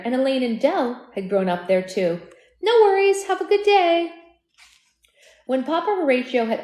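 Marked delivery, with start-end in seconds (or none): 2.6-4.1 bright greeting. Real, not acted. Narrator greets a customer off-book.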